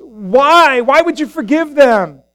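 acted self-righteous indignation; indignant protest. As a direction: demand an explanation, drawing out the why. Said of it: "the religious elite of the day".

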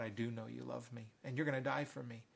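low, soft, gentle reassurance; hushed voice of a forgiving teacher; Jesus reassuring. Acted. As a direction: entrust the task quietly and tenderly. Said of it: "And Jesus says".

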